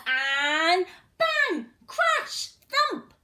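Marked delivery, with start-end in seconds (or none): none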